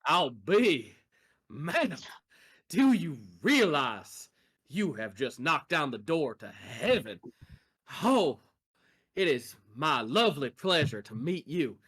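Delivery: flattering charmer voice. Acted.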